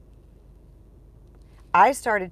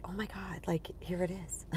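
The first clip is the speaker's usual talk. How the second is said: whispered in awe, breathless; awed whisper; 11 dB quieter than usual. Retold or imitated. Holding her first printed book, whispering in awe. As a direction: hush it out like seeing it for the first time.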